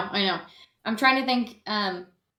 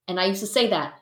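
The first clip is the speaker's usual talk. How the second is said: usual speaking voice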